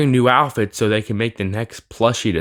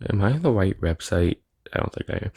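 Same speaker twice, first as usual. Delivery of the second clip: quiet, under-the-breath murmur to oneself; muttered aside; 4 semitones lower, 7 dB quieter than usual. Real, not acted. Reviewer breaking off his rant to check the website, muttered aside. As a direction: drop the voice right down, almost losing it by the end.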